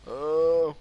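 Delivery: high, loud panicked yelps. Real, not acted. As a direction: yelp in real panic as you fall. Jetpack fails, panicked cries.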